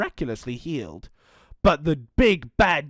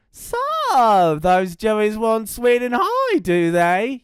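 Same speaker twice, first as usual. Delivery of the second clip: louder, slow sly dingo voice; scheming dingo; 5 semitones higher, 6 dB louder, much slower than usual. Acted. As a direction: plot it out to yourself, sly.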